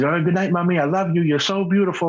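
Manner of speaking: a hint of a child saying goodnight to mommy; young son's bedtime goodnight. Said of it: "he says".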